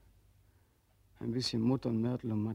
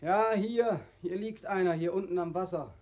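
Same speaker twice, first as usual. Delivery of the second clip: calling back; 7 semitones higher than usual. Acted. Answering from riverbank.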